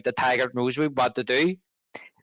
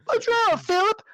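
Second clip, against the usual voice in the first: very high put-on character voice; high mock-innocent movie quote; different character. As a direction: pitch it way up, acting out the con. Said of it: "playing a different character".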